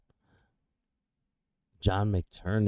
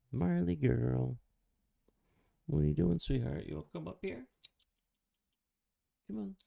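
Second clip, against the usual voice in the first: much higher, soft cooing to a dog; pet talk; 8 semitones higher, 4 dB quieter than usual. Real, not acted.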